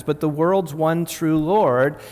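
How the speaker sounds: slow, weighty stress on world's; solemn proclamation. Acted. Preacher proclaiming to the congregation, slow and weighty. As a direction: lean hard into "world's one true Lord".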